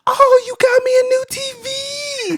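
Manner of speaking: very high, loud mock-tearful squeal; hammed-up grandmother crying out in delight; overjoyed granny. Retells granny seeing her new TV.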